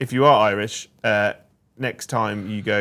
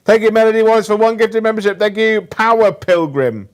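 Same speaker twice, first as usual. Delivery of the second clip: excited thanks; 10 semitones higher, 10 dB louder than usual. Acted. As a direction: belt out the thanks like an announcer. Thanking gifted members.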